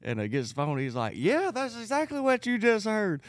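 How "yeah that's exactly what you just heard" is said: taunting pastor. Retold or imitated.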